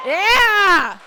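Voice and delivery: very loud, high drawn-out cheer; cheering for applause. Pretend. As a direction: belt out a crowd-rousing whoop. Cheering after asking for applause.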